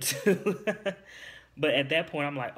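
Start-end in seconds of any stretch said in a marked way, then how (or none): 0.0-0.6 bursts into a high laugh on the last word; sheepish giggle. Real, not acted. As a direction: laugh it out, embarrassed. Laughing at herself.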